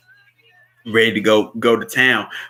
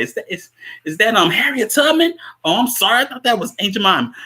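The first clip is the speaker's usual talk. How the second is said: lightly imitated captain taunt, pitched up; mocking police captain; 10 semitones higher, faster than usual. Captain mocking women walking past, lightly acted jeer. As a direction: toss out a jeering joke as a cop. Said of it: "He's on the bullhorn".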